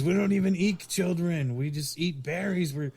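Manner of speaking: high-pitched, protesting mock lament; imitated tearful plea. Sasquatch father defending his family, imitated tearful protest. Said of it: "he's crying".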